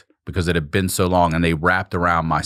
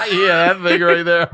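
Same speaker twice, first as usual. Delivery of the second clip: high, loud laughing through the words; 10 semitones higher, 3 dB louder than usual. Real, not acted. Laughing at his cohost's joke about a surgery photo. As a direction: laugh at the Ace of Spades joke.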